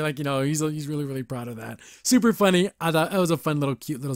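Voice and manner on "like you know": usual speaking voice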